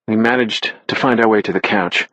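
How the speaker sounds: usual speaking voice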